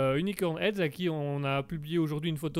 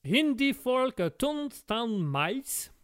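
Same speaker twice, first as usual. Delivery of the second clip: put-on slow, drawn-out English accent on the names; stretched English accent announcer voice; mock English accent; 6 semitones higher, much slower than usual. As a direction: announce the track like a hammed-up American DJ. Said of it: "quand tu fais l'accent".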